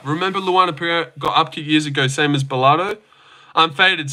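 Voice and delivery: ordinary talk, nothing marked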